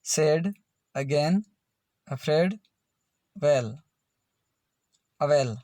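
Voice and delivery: "सैड, अगेन, अफ्रेड" one by one, AI as plain ए with no r; AI sound demo. Shown as a demonstration. Teacher contrasting AI with AIR, sounding only ए in "सैड, अगेन, अफ्रेड, वेल, अवेल". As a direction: model "वेल, अवेल" as isolated, carefully sounded words. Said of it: "तब उच्चारण सिर्फ".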